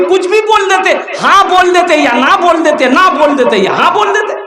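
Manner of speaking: high-pitched, insistent acted scolding; exasperated mother. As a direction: rattle off options in exasperation. Mother scolds son for silence.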